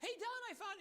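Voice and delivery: high, bubbly imitation of an excited lady; giddy old bookkeeper. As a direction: gush like she just found a treasure. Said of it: "she calls me all giddy like".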